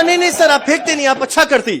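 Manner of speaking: high, placating mock South Indian accent. Trainee mollifies the offended man, mocking his South Indian accent. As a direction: protest innocence in a teasing South Indian lilt. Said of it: "हम उसका tone का ही मजाक उड़ा रहे हैं".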